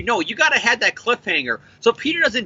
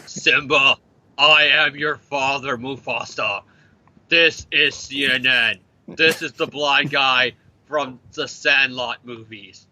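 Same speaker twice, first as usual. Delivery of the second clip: lower, slow booming lines; James Earl Jones impression; 6 semitones lower, slower than usual. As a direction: intone slowly like a movie father. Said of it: "James Earl Jones film catalog".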